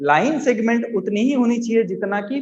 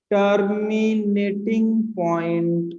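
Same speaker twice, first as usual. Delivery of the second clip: each syllable stretched on a level tone; slow dictation; 3 dB quieter, much slower than usual. Real, not acted. Teacher writing a new term for students, drawn-out dictation.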